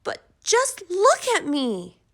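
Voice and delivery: high, loud, slow plea; distressed little girl voice; girl begs her mother to look at her stripes; upset girl. A narrator's reading. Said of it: "Camilla answered".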